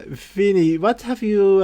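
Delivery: ordinary talk, nothing marked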